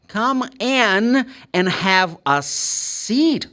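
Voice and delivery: very slow with a long EE in "seat"; slow demo. Shown as a demonstration. Teacher models the sentence slowly for students. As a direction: draw the line out slowly. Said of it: "I'm saying".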